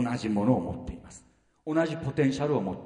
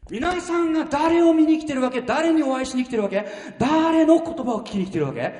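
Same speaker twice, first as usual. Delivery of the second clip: rising challenge; 9 semitones higher, 4 dB louder than usual. Real, not acted.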